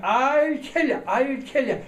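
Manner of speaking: high, loud roar of a threat; furious shout. Retold or imitated. Jealous husband shouting after the fleeing guest, roaring. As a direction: scream it down from the window.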